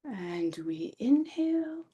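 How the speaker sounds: INHALE drawn out on a rising pitch; guided breath-in cue. Pretend. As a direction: lift "inhale" slowly upward, like filling the lungs. Channeled meditation, cueing breath in.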